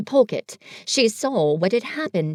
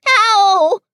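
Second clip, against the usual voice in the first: loud, much higher yelp; pained cry; 10 semitones higher, 9 dB louder than usual. A narrator's reading. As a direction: cry out as the flannel scrubs. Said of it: "cried Piglet".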